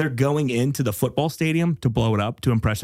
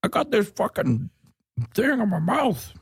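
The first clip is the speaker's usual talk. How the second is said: put-on higher, cranky old man voice; old neighbor; 9 semitones higher than usual. Griping about his teeth as a grumpy old neighbor. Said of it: "you sound like an old neighbor".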